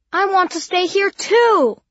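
put-on high, loud and slow child's plea. A narrator's reading. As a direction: insist loudly like a child. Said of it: "with the loud voice again".

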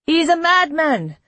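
high, forceful objection; heated protest. A narrator's reading. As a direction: protest it hotly. Mayor protests in the quarrel.

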